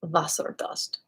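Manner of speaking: "Wasserdust" murmured low and soft like a secret; dreamy hush. Shown as a demonstration. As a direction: savour "Wasserdust" quietly, like fairy dust. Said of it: "sound like fairy dust?".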